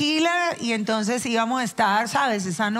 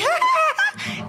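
sudden high-pitched laughing burst; imitated laugh. Re-enacting her fatal laughing fit, high mock laugh. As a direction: act out a big helpless laugh. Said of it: "que yo estaba así".

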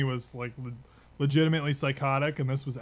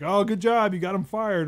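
mock praise, higher and louder; sarcastic drill sergeant; 6 semitones higher, 5 dB louder than usual. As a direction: taunt the recruits with fake praise. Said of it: "our other drill sergeant was like".

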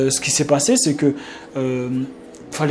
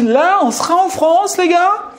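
mock scolding; 10 semitones higher, slower than usual. Mocking how France would treat non-French speakers, ironic scolding. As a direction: scold the newcomers in jest. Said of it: "Et je me suis dit".

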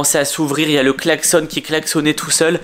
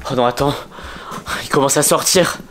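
ordinary talk

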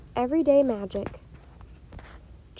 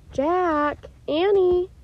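loud, high, drawn-out calling; shout from the distance; 7 semitones higher, 8 dB louder, much slower than usual. A narrator's reading. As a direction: call the children in from far away. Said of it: "a shout came from the distance".

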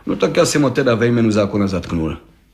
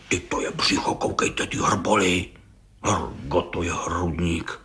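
low, hoarse-whispered admiration; hushed marvelling; 7 semitones lower, 7 dB quieter than usual. Acted. Judge admires the suspect's belly and chest, hushed. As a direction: murmur in awe at the physique.

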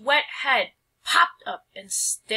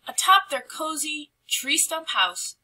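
usual speaking voice

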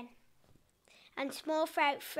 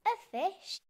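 laughing; 7 semitones higher than usual. A narrator's reading. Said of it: "she laughed".

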